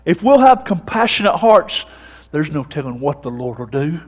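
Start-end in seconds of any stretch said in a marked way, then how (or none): none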